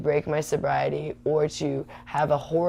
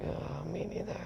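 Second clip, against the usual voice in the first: dry under-breath reply; 14 dB quieter than usual. Retold or imitated. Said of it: "And I'm like".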